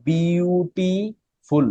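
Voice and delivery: "বিউটিফুল" drawn out slowly, each syllable separate; slow syllable-counting demo of "বিউটিফুল". Shown as a demonstration. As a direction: break "বিউটিফুল" into long, separate syllables, beau-ti-ful. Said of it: "বিউটিফুলের মধ্যে কিন্তু one সিলেবল না".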